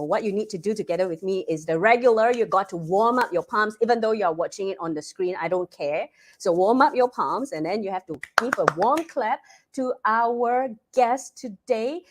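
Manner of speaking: ordinary talk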